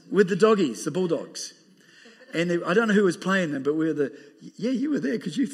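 ordinary talk

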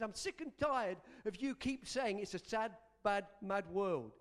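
usual speaking voice

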